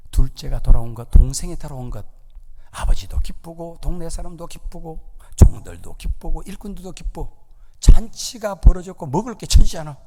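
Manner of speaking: normal talking voice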